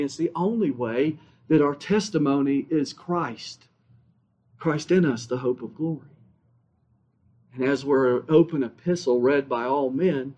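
low, soft, intimate sermon voice; hushed preaching. Acted. Preacher softly telling dear ones of Christ, hushed and low. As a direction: draw the congregation in quietly.

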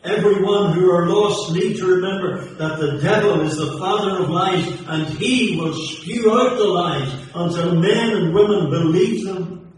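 preacher warning the lost about the devil, fervent and loud; fiery preaching. Acted. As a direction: thunder it at the congregation. Preaching at full force.

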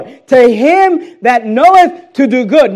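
"to him that knoweth to do good" punched slow, high and very loud; emphatic verse reading. Shown as a demonstration. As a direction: hit "knoweth" and "to do good" hard, drawing them out. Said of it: "Notice the emphasis".

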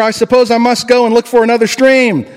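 put-on a girl turning away in despair; Jill resigned. A narrator's reading. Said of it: "said Jill".

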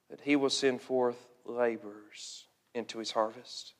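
low, very soft pulpit appeal; hushed and grave, leaving long pauses; solemn preaching. Real, not acted. Preacher urging the saved to pray for laborers, hushed and solemn.

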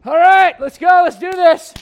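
hammed-up gung-ho shout; mock pumped-up shout. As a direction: holler it like hyping up a team. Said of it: "it's kinda like".

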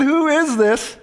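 lightly acted disbelief, voice lifted high; mock bewilderment. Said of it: "I'm thinking, ... This is strange".

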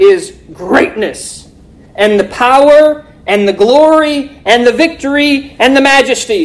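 slow, high, loud proclaimed verse; preaching scripture. Acted.

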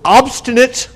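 quoted word leapt up high and tight; mocking quote of an accuser; scornful quote.

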